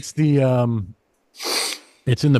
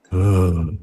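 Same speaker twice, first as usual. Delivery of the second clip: low disappointed groan; crowd groan; 6 semitones lower than usual. Retold or imitated. Disappointed audience hearing the news, low groan. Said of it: "everybody just goes".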